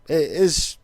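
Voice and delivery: normal talking voice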